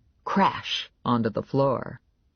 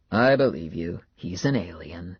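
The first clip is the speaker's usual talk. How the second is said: humouring man; 7 semitones lower than usual. A narrator's reading. Narrator voices Morelli humouring her.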